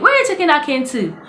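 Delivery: the landlady catching her with a cane, high and quick; landlady questioning. Retold or imitated. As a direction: ask sharply, a bit fast. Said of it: "the owner of the house was like".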